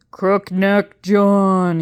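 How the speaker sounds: slow, loud calling into the dark. Acted. Professor calls to the ghost in the dark, slow and loud. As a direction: summon the ghost, drawing out the name. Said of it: "he called".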